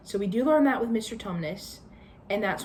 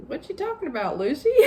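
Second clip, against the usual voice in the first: high, laughing mock disbelief; laughing teasing question; mocking siblings; 8 semitones higher, 3 dB louder, faster than usual. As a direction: tease her like a dismissive older sibling. Siblings dismissing Lucy's story.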